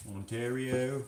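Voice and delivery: "Ontario" in an imitated thick Canadian accent; grandfather's Ontario pronunciation. Repeating how grandfather says it, mock Canadian "Ontario". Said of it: "That's how he says Ontario".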